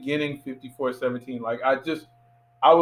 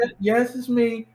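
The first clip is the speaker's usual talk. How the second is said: raspy, higher-pitched imitation; imitated raspy actress, pitched up; Jo Marie Payton; 8 semitones higher than usual. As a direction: put on her raspy voice. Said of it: "she has the raspy voice".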